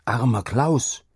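pitying, restrained cry; calling out. A narrator's reading. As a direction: exclaim it with rising pitch. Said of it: "rief er".

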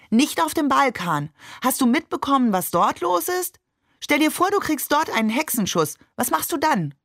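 deutlich höher, lauter, aufgeregt; aufgebrachte Mutter. Acted. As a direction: redet sich in Sorge hoch. Mutter streitet aufgebracht mit Vater. (Mutter hält dem Vater aufgeregt die Gefahren vor)

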